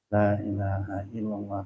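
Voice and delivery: slow, soft, low dhikr; unhurried devotional chant; slow recitation. Shown as a demonstration. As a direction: recite it drawn out and quietly. Demonstrates what the mouth recites.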